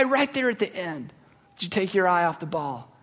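normal talking voice

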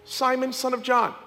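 soft, low reading of the passage; scripture reading. Acted. Preacher reading John to the congregation, soft and low. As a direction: read the verses gently over the music. Reads scripture over music.

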